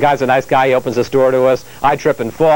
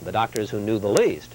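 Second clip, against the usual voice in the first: measured, deliberate correspondent read; authoritative broadcast narration with falling phrase ends; news voice-over; 10 dB quieter than usual. Acted. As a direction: weigh each key word like a TV investigative report.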